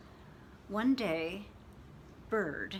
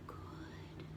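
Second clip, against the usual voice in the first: whisper; 16 dB quieter than usual. Acted. Whispered aside.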